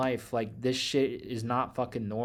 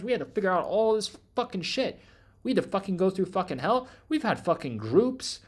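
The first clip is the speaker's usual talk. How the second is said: loud, higher, heated ranting at the audience; angry rant; 7 semitones higher, 3 dB louder than usual. Real, not acted. To viewers about years of hell, a loud heated rant. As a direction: vent it, getting louder and higher.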